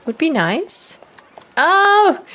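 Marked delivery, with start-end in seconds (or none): 1.6-2.2 high delighted burst of surprise; surprised cry. Real, not acted. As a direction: cry out as a favourite appears. Spots favourite characters arriving.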